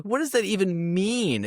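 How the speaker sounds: high, slow, mocking confusion; baffled disbelief. Mocking a dumb movie line, high baffled disbelief. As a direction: throw up your hands at it. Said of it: "and I'm like".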